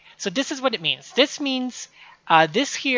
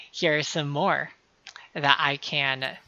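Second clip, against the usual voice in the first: usual speaking voice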